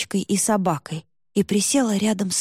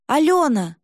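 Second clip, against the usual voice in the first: calling out; 6 semitones higher, 4 dB louder than usual. Acted. Calling after running friend.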